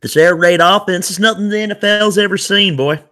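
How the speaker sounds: joining the bit, boasting in a fake West Texas accent. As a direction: crow like a West Texas booster. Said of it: "fake, like, West Texas accents".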